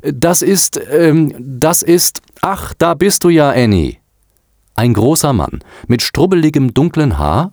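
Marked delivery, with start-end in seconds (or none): 0.0-2.2 high, halting hesitation; stalling. A narrator's reading. Girl stalls as the caller approaches. 2.4-4.0 high, raised interjection; finding her. A narrator's reading. Man interrupts on finding the girl.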